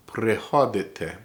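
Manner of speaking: Prehodite, each syllable drawn out slowly; slow careful Prehodite for learners. Shown as a demonstration. Teacher says Prehodite slowly so students can follow. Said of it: "I'm gonna say it slow".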